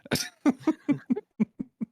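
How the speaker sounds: sudden high-pitched cackling laugh; high cackle. Real, not acted. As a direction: burst into a shrill cackle. Reacting to insult in game.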